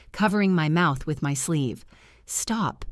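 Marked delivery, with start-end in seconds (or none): 2.2-2.9 hushed through stifled laughter; whispering. Acted. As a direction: breathe it out, trying not to laugh. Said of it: "I whispered".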